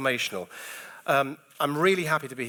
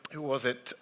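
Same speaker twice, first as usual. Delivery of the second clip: ordinary delivery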